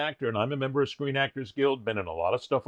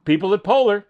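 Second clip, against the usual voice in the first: calling out; 6 semitones higher, 6 dB louder than usual. Acted. Calling out to sponsor.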